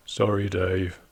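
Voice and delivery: low, quiet, grudging mumble; half-hearted forced sorry; insincere apology. Retold or imitated. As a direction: apologise like a kid who doesn't mean it. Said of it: "could you say it like you mean it".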